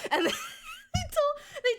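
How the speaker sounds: high squeal of laughter; laughing. Real, not acted. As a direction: laugh through the words. Laughing mid-story.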